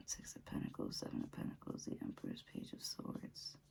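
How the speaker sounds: very soft whispered murmur; whispered to oneself. Real, not acted. Tarot reader naming cards to herself, whispering. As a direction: whisper the card names under your breath.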